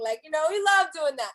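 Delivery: ordinary delivery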